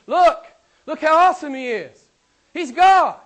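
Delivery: loud, high, awestruck preaching; exclaimed proclamation. Acted. Preacher voicing Paul holding up Jesus, loudly exclaiming. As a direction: cry it out like a herald pointing at a wonder.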